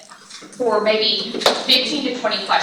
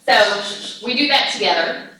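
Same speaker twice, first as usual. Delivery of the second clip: ordinary delivery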